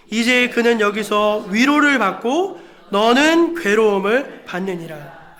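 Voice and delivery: slow, emphatic Bible recitation; stately, drawn-out pulpit reading; scripture reading. Real, not acted. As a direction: read the verse out with solemn weight. Reads scripture aloud to congregation.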